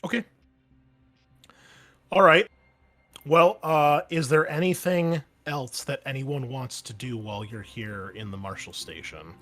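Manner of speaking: ordinary talk, nothing marked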